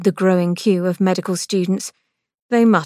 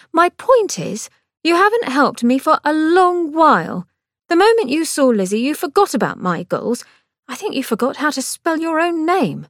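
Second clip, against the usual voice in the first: much higher, louder, heated sister; Angelica, accusing; 9 semitones higher, 3 dB louder than usual. A narrator's reading. Sister accusing brother of neglect, heated. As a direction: press the accusation.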